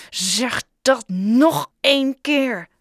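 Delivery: slow, loud, menacing warning; menacing threat. Acted. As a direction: dare him to say it again. Joe threatening Bob.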